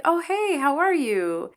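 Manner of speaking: high, bright, overly friendly hello; fake cheerful greeting. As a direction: chirp it like you're thrilled to see him.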